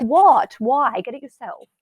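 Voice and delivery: loud and high, sharp burst that drops away at the end; out of patience, bite it off; exasperated snap. Retold or imitated. Parent snapping at a child who keeps asking for a snack, loud exasperated outburst.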